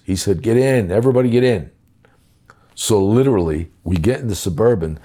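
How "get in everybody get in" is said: louder, higher, cheerfully bossy "get in"; waving the whole crew into the car; insistent order. Retold or imitated. The president telling everyone to pile into his Suburban, loud and insistent.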